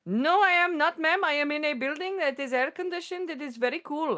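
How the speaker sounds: high, sugary call-center politeness; scam caller voice. Retold or imitated. Retelling the scam caller's sweet reply in a high voice. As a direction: lightly mimic an overly polite scammer. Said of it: "I'm like".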